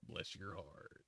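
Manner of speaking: mock southern drawl imitating her; quiet mocking southern echo. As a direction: parrot the phrase in a lazy southern drawl. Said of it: "Did you just mock me because of how I said that".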